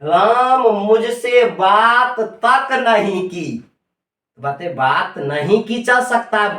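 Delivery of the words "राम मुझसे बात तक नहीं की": very slow, weight on बात तक; stress on तक. Shown as a demonstration. Teacher demonstrating तक to students, slow weighted stress on तक. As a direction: drag out बात तक to show the emphasis. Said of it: "देखिए बल दिया जा रहा है".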